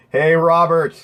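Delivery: normal talking voice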